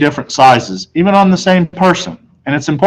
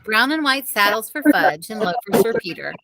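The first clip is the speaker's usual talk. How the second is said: higher, softer mother ordering; mother's voice; 7 semitones higher, 8 dB quieter than usual. A narrator's reading. Mother telling the salesman what to bring, higher voice. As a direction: state the order politely. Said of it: "My mother answered".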